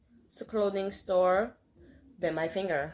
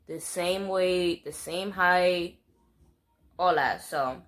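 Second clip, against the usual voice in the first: ordinary talk, nothing marked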